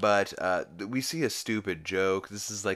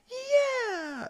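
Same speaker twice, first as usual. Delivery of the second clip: very high, drawn-out cheer; exultant whoop; 4 dB louder than usual. Retold or imitated. Peter still cheering after Falkor crashes, exultant whoop. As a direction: whoop "yeah" at the top of your range.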